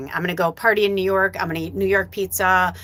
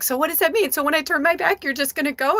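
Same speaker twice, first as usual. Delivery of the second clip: mom panicking; 9 semitones higher than usual. Retold or imitated. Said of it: "she's like".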